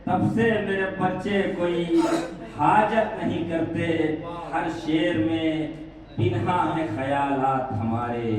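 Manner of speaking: measured mushaira recital, lines drawn out in phrases; poetry recitation. Acted. Poet presenting couplets to a mushaira audience, declaimed recitation. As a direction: recite each ghazal couplet with pauses for the audience.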